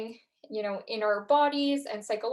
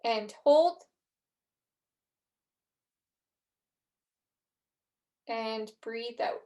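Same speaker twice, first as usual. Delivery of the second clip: calm guided breathing cues, long pauses. Acted. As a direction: lead the breath counts calmly.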